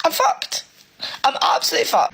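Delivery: high, breaking despair; really emotional. Real, not acted. Single parent out of money before month's end, really emotional. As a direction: let the voice climb and give out. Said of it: "I'm getting really emotional".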